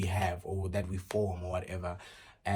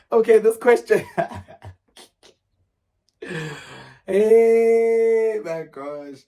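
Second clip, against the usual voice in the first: much higher and louder, flustered; flustered exclaiming; 13 semitones higher, 15 dB louder, much slower than usual. Real, not acted. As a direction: cry out at the outrageous question. Reacts to freaky question, laughing.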